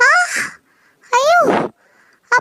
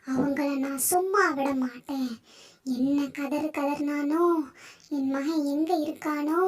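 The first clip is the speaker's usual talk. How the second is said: grieving snake goddess; 7 dB quieter than usual. Acted. Snake goddess grieves her killed son, soft and mournful. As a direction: mourn the son quietly, vowing revenge.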